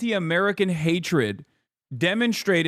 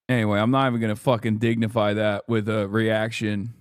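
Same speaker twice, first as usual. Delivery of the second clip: ordinary talk, nothing marked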